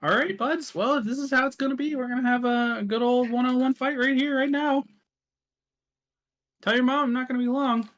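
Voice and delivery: high, folksy challenger; Death. Acted. Death challenging the players one-on-one, high folksy fighting voice. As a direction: throw down a fight challenge, pitched high and quick. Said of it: "Death suddenly is towering over you".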